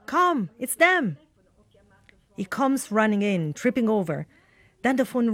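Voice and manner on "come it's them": high, drawn-out acted summons; calling out excitedly. Woman calling Mauro to the phone, urgent excitement. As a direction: call someone over, thrilled, in a retelling. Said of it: "called Mauro".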